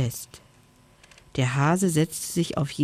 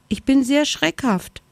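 timid snow hare; 9 semitones higher than usual. A narrator's reading. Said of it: "sagte er".

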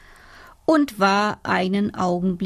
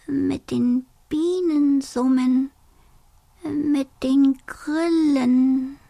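high dreamy dormouse voice; 5 semitones higher than usual. Acted.

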